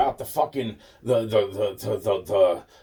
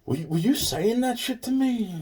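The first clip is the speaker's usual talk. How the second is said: much higher, suspicious question; mock accusation; 12 semitones higher than usual. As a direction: ask it high and incredulous. Accusing question in retold scene.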